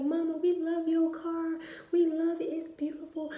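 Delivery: put-on high, excited childlike voice; imitating her young daughters gushing; little daughters' voice. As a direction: squeal it like excited little girls. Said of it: "They was like".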